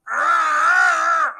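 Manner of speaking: put-on a loud, high, snarling child's growl, shouted out; imitated growl. Little boy growling as the prayer ends, loud snarling growl. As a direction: let out an awful possessed growl. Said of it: "I mean, just growling, making this awful noise".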